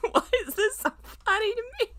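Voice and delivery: fast, high squeak through laughter; breathless giggle of disbelief; squeaky laughing. Real, not acted. Laughing at himself.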